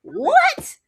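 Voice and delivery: high, loud disbelieving squeak; baffled high yelp; incredulous. Real, not acted. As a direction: pop it up in shock. Speaker's incredulous reaction.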